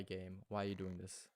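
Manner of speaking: usual speaking voice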